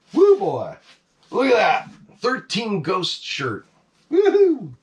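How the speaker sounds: loud, high delighted cheering; thrilled woohoo; excited whoop. Real, not acted. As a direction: whoop at the find. Excited about Thirteen Ghost shirt.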